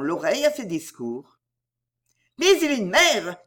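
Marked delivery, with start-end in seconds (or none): 2.4-3.4 high, loud angry cry; angry outburst. Acted. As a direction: scold in outrage. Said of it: "d'un ton de colère".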